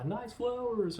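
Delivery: high, sing-out imitation of a passerby; mock call-out. Passing car calling to the newlyweds, high mock call-out. Passing car's driver calls out.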